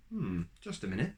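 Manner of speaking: ordinary delivery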